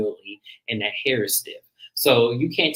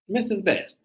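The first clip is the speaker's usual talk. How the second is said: usual speaking voice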